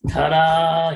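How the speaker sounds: slow, high, loud fanfare; ta-da reveal. Acted. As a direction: stretch it out like a reveal.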